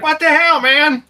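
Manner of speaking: high yelled "what the hell"; startled shout. Retold or imitated. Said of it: "As Rory comes running after, like".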